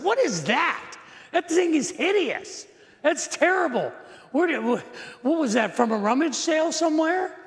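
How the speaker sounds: high-pitched mock disgust; appalled daughters. Daughters' disgust at the vase acted.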